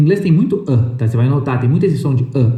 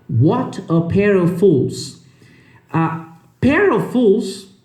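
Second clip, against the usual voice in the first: slow careful reading; 3 semitones higher, much slower than usual. Shown as a demonstration. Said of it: "tem que explicar pra vocês".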